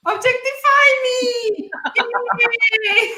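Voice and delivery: high, slow, laughing cheer of an eager man; laughing mock cheer. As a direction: beg to be objectified through laughter. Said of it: "look how men react".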